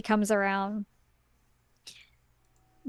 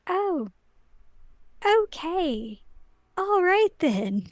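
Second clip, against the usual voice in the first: high, drawn-out, hesitant acceptance; mock-chastened, pitched up with pauses; taken aback; 8 semitones higher, slower than usual. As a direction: stretch out each reluctant agreement. Retold man chastened by the king.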